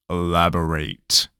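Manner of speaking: slow, cold command; one cold word; sharp. Acted. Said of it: "Eris said sharply".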